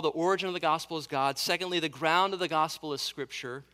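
fast run-through of a known heading; brisk summary pace; quick recap. Shown as a demonstration. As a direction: rattle it off as a reminder. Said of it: "He says, first of all".

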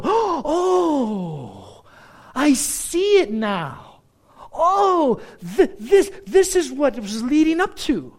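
a high, loud "oh" as it suddenly clicks; sudden realization. Retold or imitated.

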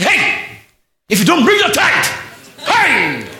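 high, loud threat over tithes; scolding pastor. Acted. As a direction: bellow a warning like a stern pastor. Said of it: "You want the pastors to say".

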